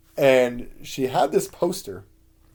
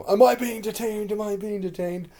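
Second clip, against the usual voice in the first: high-pitched mock detainee protest; Batman; 11 semitones higher, 4 dB louder than usual. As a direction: put on a much higher character voice, imitating a sketch role. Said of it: "he basically played it like".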